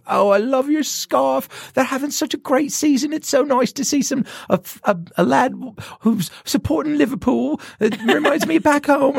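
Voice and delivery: very high-pitched British woman's voice; British accent. Retold or imitated. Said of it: "came up with a British accent".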